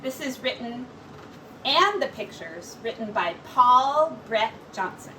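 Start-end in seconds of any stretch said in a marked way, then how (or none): none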